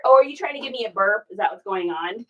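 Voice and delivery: normal talking voice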